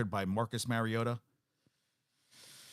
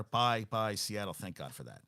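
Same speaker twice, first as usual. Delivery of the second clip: ordinary talk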